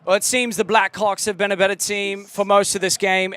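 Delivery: mock English football commentator; hammed-up English accent commentary. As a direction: put on a British broadcaster accent. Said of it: "Immediate approval from the U K, let it rip".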